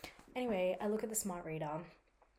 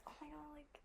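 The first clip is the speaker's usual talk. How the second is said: whispered quote; 8 semitones higher, 14 dB quieter than usual. Retold or imitated. Retold excited quote to friend.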